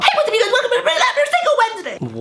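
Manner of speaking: put-on high, fast, laughing kid YouTuber greeting; [name] impression. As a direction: squeal it like a hyper kid star. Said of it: "who the hell am I, [name]?".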